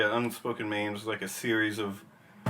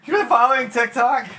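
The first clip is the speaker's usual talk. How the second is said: much higher, louder, laughing; surprised and tickled; delighted surprise; 12 semitones higher, 10 dB louder than usual. Real, not acted.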